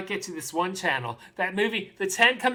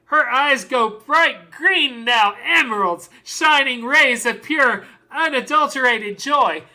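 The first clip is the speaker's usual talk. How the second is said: rapturous; 4 semitones higher, 6 dB louder than usual. Acted. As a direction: build each image with overflowing joy. Rapturous climax of father's monologue.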